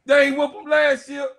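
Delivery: yelling the repeat at the rival fan; shouted taunt. Real, not acted.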